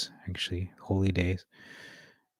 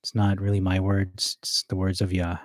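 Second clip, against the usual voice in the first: normal talking voice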